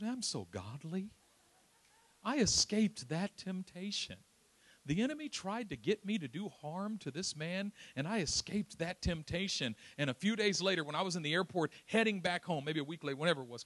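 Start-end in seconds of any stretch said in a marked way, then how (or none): 0.0-9.7 smug self-congratulation. Retold or imitated. Smug self-praise, mocking himself.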